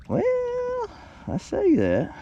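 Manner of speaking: high pitched drawn-out well; surprised. Real, not acted. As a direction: pleasantly caught off guard. Surprised by bigger fish.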